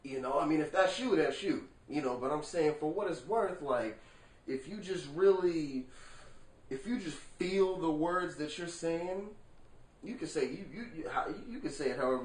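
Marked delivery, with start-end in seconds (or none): none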